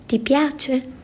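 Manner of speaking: put-on high, bright child's question; fresh girlish voice. Girl showing off her new fur coat. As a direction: ask it lightly like a young girl. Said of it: "disse con la voce fresca".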